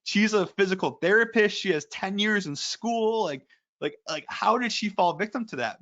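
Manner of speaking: high-pitched exasperated disbelief; mock indignation, pitch pushed up; imitating mad husband. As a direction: retell a husband's indignant outburst. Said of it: "and he was so mad".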